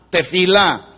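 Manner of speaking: "tefilá" avec l'accent sur le dernier a, te-fi-LÁ; pron tefilá. Shown as a demonstration. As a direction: dites "tefilá" en appuyant sur la syllabe finale. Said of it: "con F de feo y acento en la A".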